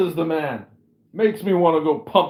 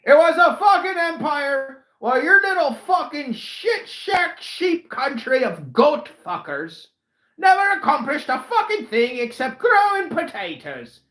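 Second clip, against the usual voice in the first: furious high yelling rant; angry shouting; 8 semitones higher than usual. Acted. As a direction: scream the insults. Drunken rant at Irish guest.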